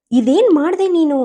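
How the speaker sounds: startled by cold water, protesting; shocked protest. Acted. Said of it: "ಮುಖ ಕಿವಿಚಿ ಕೇಳಿದ".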